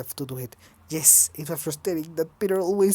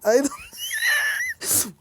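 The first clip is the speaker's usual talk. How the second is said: laughing. Real, not acted. Reader losing it while voicing Kurt, laughing. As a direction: break into laughter on "I don't". Breaks into laughter mid-line.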